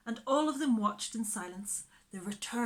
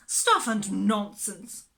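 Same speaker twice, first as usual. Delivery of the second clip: louder, slow cross dismissal; 8 dB louder, slower than usual. Acted. Miss Lark dismissing Mary Poppins, said crossly. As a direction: brush it off crossly. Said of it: "said Miss Lark crossly".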